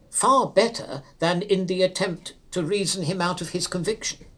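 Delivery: ordinary delivery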